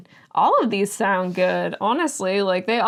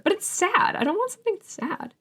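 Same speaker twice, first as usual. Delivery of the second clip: high, small pouting voice; reluctant whine; 11 semitones higher than usual. Real, not acted. Reader turning down a sad book, reluctant whine. Reacts to a blurb called sad.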